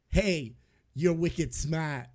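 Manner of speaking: slow, light Boston accent imitation; imitating a Boston accent. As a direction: drag out "Hey, you're wicked smart" in a mild Boston accent. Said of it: "somebody with a crazy accent".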